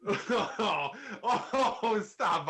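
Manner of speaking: breathless laughing oh; high laughing gasps. Real, not acted. Laughing at a joke.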